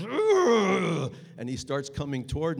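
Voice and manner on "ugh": loud, high angry roar; loud menacing grunt. Retold or imitated. The big attacker roaring at the narrator, loud grunt. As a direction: bellow at him like a threatening brute. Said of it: "and he goes".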